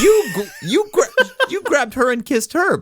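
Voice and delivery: high, laughing mock woman's retort. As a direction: squeal it out in an exaggerated high voice, laughing. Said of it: "It's like".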